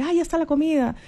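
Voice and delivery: high, sing-song call that the food is ready; a mother calling from the kitchen; mom calling out. Retold or imitated. Mother calling her child to eat, high sing-song call. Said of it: "tu mamá te dice".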